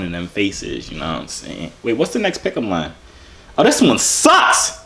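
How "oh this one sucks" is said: loud, high, drawn-out complaint; disgusted outburst. Real, not acted. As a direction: blurt it out loud and high, stretching the words. Reacts to a bad pickup line.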